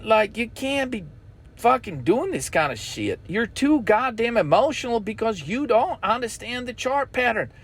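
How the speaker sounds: normal talking voice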